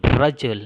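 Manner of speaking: "Rajul" loud on "ra", falling after; stress on first syllable. Shown as a demonstration. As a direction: punch the "Ra" in "Rajul". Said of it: "mempunyai dua suku kata". (tekan keras "Ra" dalam "Rajul")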